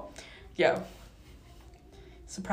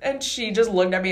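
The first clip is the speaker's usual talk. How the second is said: ordinary talk, nothing marked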